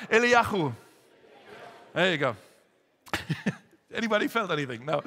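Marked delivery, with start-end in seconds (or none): 0.1-0.7 Eliyahu with a forceful, phlegmy kh; spit it out. Shown as a demonstration. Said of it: "Spit it out".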